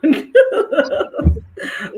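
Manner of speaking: high burst of real laughter; loud laugh. Real, not acted. Laughing at losing her voice, loud laugh. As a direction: laugh out at the memory.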